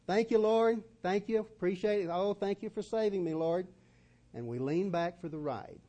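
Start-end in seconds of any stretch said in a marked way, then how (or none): 0.1-3.7 high, loud, gushing gratitude to the Lord; gushing thanks. Retold or imitated. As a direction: thank Him breathlessly, overjoyed. Grateful believer thanking the Lord.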